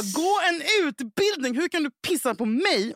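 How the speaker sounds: high, drawn-out indignant scolding; retold angry rebuke. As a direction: tell off the officer, stretching the words. Retold furious rebuke to the cop.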